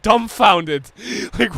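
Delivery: ordinary talk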